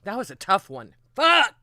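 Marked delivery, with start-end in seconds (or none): 1.1-1.5 high, loud curse; frustrated outburst. Real, not acted. Streamer cursing a tough loss with a sharp loud shout.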